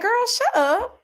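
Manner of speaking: high-pitched retold protest; fed-up retort. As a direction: shoot up high to shush her. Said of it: "I was like".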